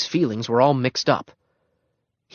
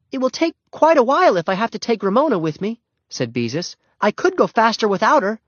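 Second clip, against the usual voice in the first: put-on higher girl's voice, a bit put-upon; older sister; 11 semitones higher, 4 dB louder than usual. A narrator's reading. Beezus bargaining with Henry over taking Ramona, put-upon. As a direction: sigh at having to take the little one.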